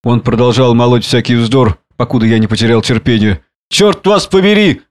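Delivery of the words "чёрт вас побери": high, drawn-out angry curse; exclaiming. A narrator's reading. Drunken man answers a sharp remark, exclaiming angrily. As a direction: curse him in exasperation. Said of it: "воскликнул он в ответ".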